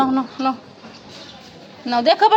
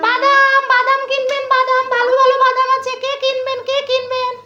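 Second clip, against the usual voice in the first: higher, sing-song street cry; peanut hawker call; 4 semitones higher than usual. Pretend. Mother plays peanut hawker.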